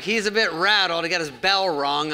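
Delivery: high, loud folksy doctor voice; imitating old doctor. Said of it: "this guy's like".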